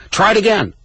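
high-pitched desperate command; urgent call. Acted. Reader voicing the cry to retry the battery, urgent call. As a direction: call out urgently over an emergency.

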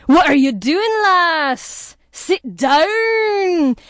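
loud, high-pitched mock Scottish scolding; Scottish accent. Scottish construction worker tells off the lady at the seance, exasperated Scottish accent. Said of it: "Only he was Scottish, so it was more like".

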